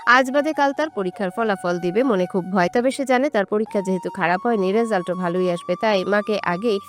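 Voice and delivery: ordinary delivery, nothing marked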